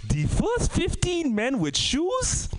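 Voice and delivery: slow punchline. Real, not acted. Punchline said slowly.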